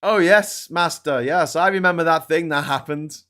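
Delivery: grovelling "yes, Master" voice; hunchback assistant. Pretend. Parodying Anakin answering his master.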